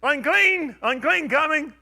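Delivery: loud, high warning cry; leper's call. Retold or imitated. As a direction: announce yourself to the crowd. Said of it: "That must be how lepers".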